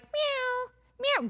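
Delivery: high, soft kitty meow; sweet meow. Retold or imitated. Showing how most cats meow, a high soft mew. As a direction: mew like a dainty cat. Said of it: "Most cats are like".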